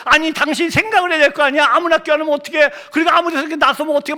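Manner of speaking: high-pitched, indignant telling-off; angry scolding. Retold or imitated. Husband scolding his wife in the car, angry and high. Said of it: "제가 막 화를 냈어요".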